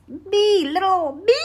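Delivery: high, loud little-me squeak; exaggerated high-pitched protest; mock incredulous squeal. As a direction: squeal it in playful disbelief. Mock disbelief at being asked.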